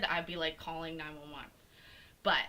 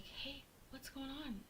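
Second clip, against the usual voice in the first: soft, higher, slow retold question; gentle check-in; 7 semitones higher, 12 dB quieter than usual. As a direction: softly check on someone crying next to you. Retells checking on crying roommate.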